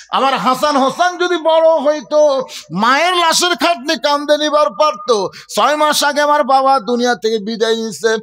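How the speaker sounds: high preaching plea; impassioned lament. Acted. Fatema speaking of her young sons, impassioned lament. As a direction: lift the pitch and plead as a mother.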